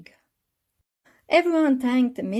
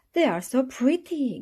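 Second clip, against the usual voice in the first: gasped; 7 semitones higher, 3 dB louder than usual. A narrator's reading. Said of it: "gasped Peppa".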